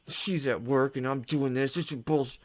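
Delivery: slowed, strained mock grumbling; resentful inner voice. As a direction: act out a mildly worked-up, resentful thought. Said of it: "when you start to get those thoughts".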